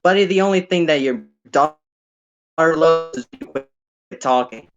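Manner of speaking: roasting comeback. Retold or imitated. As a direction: fire off the comeback fast. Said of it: "the person in the car would say".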